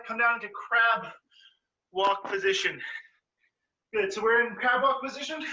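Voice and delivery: ordinary talk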